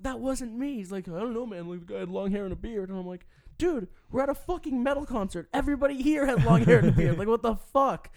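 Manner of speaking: high, loud, exasperated protest; indignant protest. Retold or imitated. Kicked out of a metal concert, arguing with security, outraged. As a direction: push back at the guard in disbelief.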